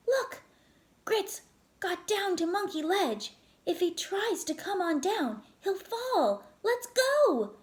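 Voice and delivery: desperate to go save his dog; alarmed. Acted. Axel to his dad about Grits, urgent and high. Boy sees dog in danger.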